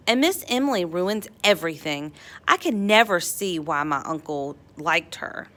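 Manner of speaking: plain reading voice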